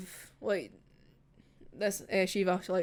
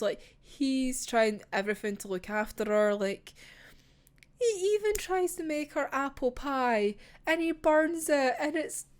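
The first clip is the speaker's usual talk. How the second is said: normal talking voice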